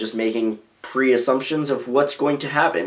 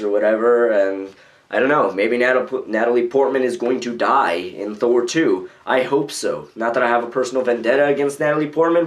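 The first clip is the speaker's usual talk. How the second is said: ordinary delivery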